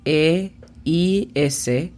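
"E I S F O L" sounded out slowly, letter by letter; slow spelling. Shown as a demonstration. Teacher spelling out pronunciation for students, slowly and deliberately. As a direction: spell the phonetics out very slowly for learners. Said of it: "La pronunciación fonética de esta es".

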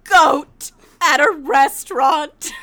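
slow, loud, hammed-up indignation; imitated outrage. Friend phoning about Casper's betrayal, mock outrage. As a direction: build to a loud, appalled reveal. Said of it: "incredibly upset".

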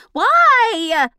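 long, high, loud drawn-out why. Shown as a demonstration. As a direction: stretch the why out big and loud. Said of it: "The why was longer".

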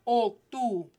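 O, tú as "oke-TOO" in pieces; closed Spanish O. Shown as a demonstration. Teacher self-correcting syllable by syllable, closed O. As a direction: correct to a round O, then tú. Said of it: "you need to check yourself".

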